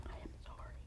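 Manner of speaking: unvoiced whisper; whispered apology. Real, not acted. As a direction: whisper it as an aside. Aside after caffeine remark.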